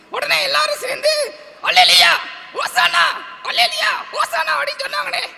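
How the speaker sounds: high puppet storyteller acting out a cheering crowd; டிங்கன். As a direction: cry out the praises in a squeaky voice. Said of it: "ஒரு கதை சொல்லணும்னு". (பொம்மைக் குரலில் கூட்டத்தின் ஆரவாரம்)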